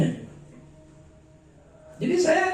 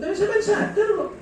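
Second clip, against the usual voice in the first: mock scolding shout. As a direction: call him out, half-joking. Scolding the quarrelling husband.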